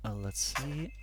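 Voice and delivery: whispered aside under the breath. Real, not acted. GM checking a spell aside, whispered. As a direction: murmur it to yourself.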